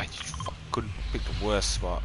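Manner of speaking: breathy curse under breath; whispered curse. Real, not acted. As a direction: mutter it to yourself. Muttered curse at friend.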